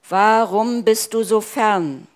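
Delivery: loud, high, anguished question; despairing cry. Acted. Preacher voicing a believer crying out to God, loud anguish. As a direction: hurl the question at a distant God. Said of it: "unsere Verzweiflung hinausschreien".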